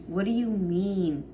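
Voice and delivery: normal talking voice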